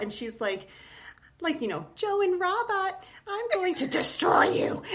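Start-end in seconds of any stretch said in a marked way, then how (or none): 2.0-4.8 much higher, slow, squeaky villain robot voice; a tiny robot gloating over its evil plan; little evil bot. Retold or imitated. Reading a bedtime book to the girls, higher, slow evil little robot. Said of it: "there's this little evil bot".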